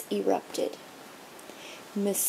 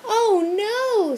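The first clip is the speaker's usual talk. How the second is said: dismayed; 10 semitones higher, 3 dB louder than usual. A narrator's reading. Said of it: "said Cheryl".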